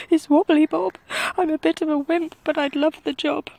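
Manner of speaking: high, nervous wimpy cook voice; timid cook. A narrator's reading. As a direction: speak like a shy wimp. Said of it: "said the cook".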